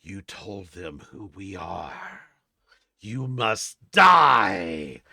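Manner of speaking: slow, menacing creature voice swelling to a roar; monstrous voices in the head. Acted. Frog-like creatures telepathically threaten the party, slow monstrous menace. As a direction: drag out the threat, then bellow the last word. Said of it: "you hear these voices in your head".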